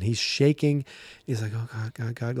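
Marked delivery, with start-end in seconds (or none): 1.5-2.4 soft, stammered mock panic; acted fright. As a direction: imitate a frightened man muttering. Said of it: "you could tell he is frightened".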